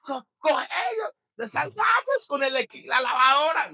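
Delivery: a mock frantic yell about the washing machine. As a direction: pretend to panic over saving the appliances. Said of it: "Nadie dijo".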